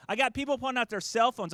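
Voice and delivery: usual speaking voice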